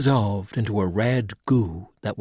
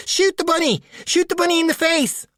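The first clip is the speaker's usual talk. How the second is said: much higher, loud frantic yell; panicked shout; 3 dB louder than usual. Acted. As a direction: scream the order in panic. Monster rabbit lunges at them.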